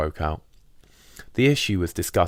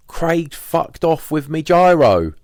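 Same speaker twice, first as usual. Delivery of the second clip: higher, louder and slow, dazed; Pauline's voice; 11 semitones higher, 8 dB louder, slower than usual. Retold or imitated. Pauline telling the narrator Craig took her money, slow, higher and louder. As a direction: drag it out in stunned shock. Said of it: "she just replied".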